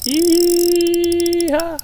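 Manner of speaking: high, loud cowboy whoop; yee-haw cheer. Real, not acted. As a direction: whoop it out like a rodeo rider. Hooking a fish, cheering.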